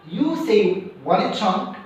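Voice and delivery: normal talking voice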